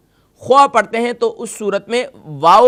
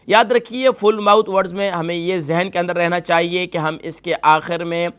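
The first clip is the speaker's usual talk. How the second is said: usual speaking voice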